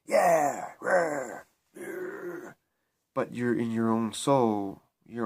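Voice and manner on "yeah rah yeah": higher, wordless jeering cries; mock crowd noise. Speaker mimics the crowd you go along with, mock jeering.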